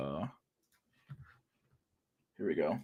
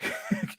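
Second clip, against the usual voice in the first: quick high snicker, softer; a stifled giggle; 8 dB quieter than usual. Real, not acted. Mocking the soldier's situation, quick snicker. Laughs before mock quote.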